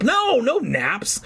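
high, slow insistent no; urgent refusal. Acted. Cooper to sleepy Fergie, high insistent refusal. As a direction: stretch it out in protest.

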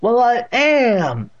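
very slow, high, loud declaration; declaring great-aunt. A narrator's reading. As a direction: draw it out proudly as the deaf aunt. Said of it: "Catherine declared".

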